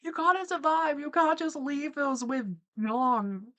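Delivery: high, wailing plea; play-acted high-pitched pleading; mock desperate plea. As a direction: beg like a horrified onlooker, hammed up. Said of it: "they're screaming like".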